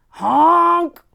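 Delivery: loud, high crying honk; scared honk. A narrator's reading. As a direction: honk like a truck calling in fright. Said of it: "and he sounded scared".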